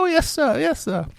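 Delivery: put-on high, meek obedient boy; meek Oliver. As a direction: cower and agree with the master. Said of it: "it's my Oliver impersonation".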